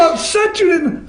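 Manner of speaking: normal talking voice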